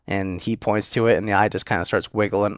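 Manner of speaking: ordinary delivery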